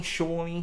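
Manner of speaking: Shawnee with an AW vowel, SHAW-nee; name variant. Shown as a demonstration. Host listing versions of the name, Shawnee as SHAW-nee. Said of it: "we've heard so many different, uh, versions".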